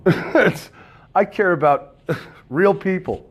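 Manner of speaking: laughing. Real, not acted. Laughing through her words.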